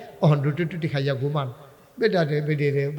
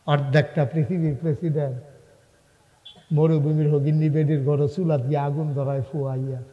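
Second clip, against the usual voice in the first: ordinary delivery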